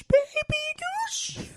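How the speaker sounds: very high, slow falsetto; falsetto taunt. Acted. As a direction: squeak it out in falsetto. Repeated in falsetto.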